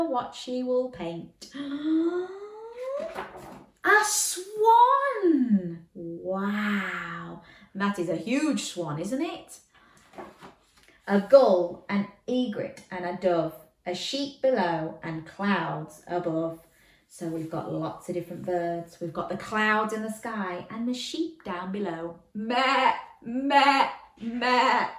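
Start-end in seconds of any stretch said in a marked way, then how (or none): 1.5-5.9 high, loud excited reveal. Acted. Excited reveal of swan picture. 22.3-24.9 bleating like a sheep. Pretend.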